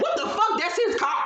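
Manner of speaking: disbelieving outburst. Retold or imitated. Group reacts in disbelief.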